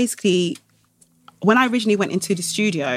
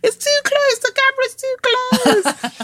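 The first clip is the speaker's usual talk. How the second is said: very high, hammed-up panic; mock distress, slowing on the end; horrified protest; slower than usual. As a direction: squeal it as if recoiling from the lens. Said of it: "I was just, like, horrified".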